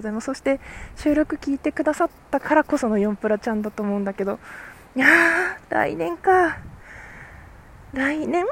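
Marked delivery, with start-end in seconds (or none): 4.9-8.4 high, loud surprised exclaim. Real, not acted. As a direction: caught off guard by news. Learns the store closes next year.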